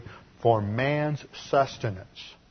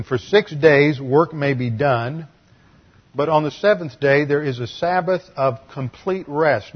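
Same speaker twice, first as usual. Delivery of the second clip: Scripture reading; 5 dB louder than usual. Acted. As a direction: read each word of the verse deliberately. Preacher reads Scripture aloud.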